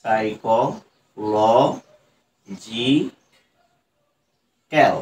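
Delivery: সাইকোলজিক্যাল stretched out very slowly, one syllable at a time; teacher sounding out সাইকোলজিক্যাল syllable by syllable; broken into syllables. Shown as a demonstration. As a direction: pull সাইকোলজিক্যাল apart into separate beats. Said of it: "কি করে একদম ভেঙে ভেঙে উচ্চারণ করছি".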